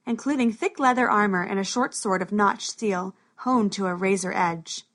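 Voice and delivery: usual speaking voice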